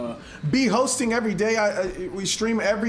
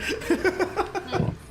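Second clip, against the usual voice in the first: high burst of laughter; big laugh; 11 semitones higher, 5 dB quieter than usual. Real, not acted. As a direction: crack up laughing. Cracking up at the joke.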